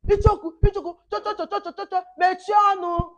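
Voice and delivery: high, loud imitation of a mother scolding. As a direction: mimic mom shutting up a chatterbox. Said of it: "So then mommy say".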